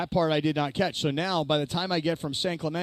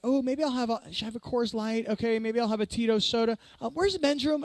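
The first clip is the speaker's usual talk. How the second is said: higher-pitched pretend customer musing; mock casual pondering, pitched up; play-acted browsing; 5 semitones higher than usual. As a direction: act like you might order, just as cover. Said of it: "I love that you play this out".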